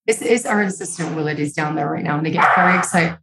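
usual speaking voice

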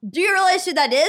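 put-on match the big accent energy; heightened Galinda. Galinda line, louder now.

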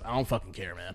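low, soft, dismissive reply; imitated indifference. Cab guy brushing off the dented car, low indifference.

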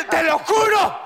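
high-pitched frantic insistence; overacted, high-pitched pleading; acted outburst. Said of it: "Estoy actuando".

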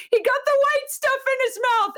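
imitating a child yelling. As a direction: shout it like a frightened little boy. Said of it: "my seven-year-old is yelling".

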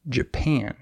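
Japan said ja-PAN, second syllable long and stressed. Shown as a demonstration. Teacher repeating the example word, second syllable of Japan stressed. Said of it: "the second syllable is stressed".

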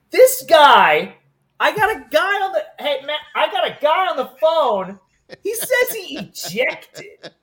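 high, loud, urgent shout to the partner; alarmed dispatcher call. Retold or imitated. Dispatcher calls a coworker over, high and loud, alarmed. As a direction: blurt it out to Matt, half laughing.

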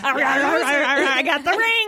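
slow, hammed-up contestant with a laugh; mock cake diver. Joking with co-hosts about digging a ring from cake, hammed-up. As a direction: draw it out like a silly cake-diving contestant.

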